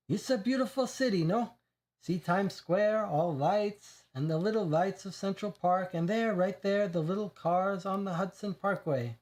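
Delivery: put-on lightly accented boast over his toy city; Italian accent, proud. Reggio shows his model city.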